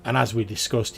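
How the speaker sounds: normal talking voice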